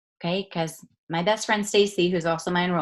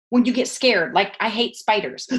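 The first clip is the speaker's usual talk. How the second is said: ordinary talk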